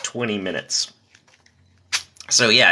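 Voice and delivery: ordinary talk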